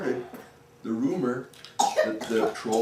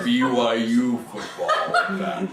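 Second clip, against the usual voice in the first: troll voice; 2 semitones lower than usual. Acted. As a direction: chuckle it out as a proud troll. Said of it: "And the troll said".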